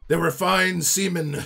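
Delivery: firm word to the assembled crew; ship's officer's solemn pronouncement. Acted. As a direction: raise your voice in tribute to the dead. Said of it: "and he's like".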